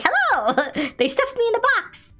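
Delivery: high, laughing mock robot girl; mock Megan voice. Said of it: "She's like".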